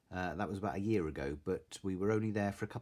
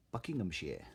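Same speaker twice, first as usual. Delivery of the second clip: usual speaking voice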